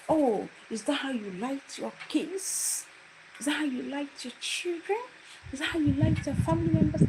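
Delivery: high rising mocking questions, building; taunting lecturer. Lecturer taunting the student about her family, high mocking. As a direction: sneer at her upbringing.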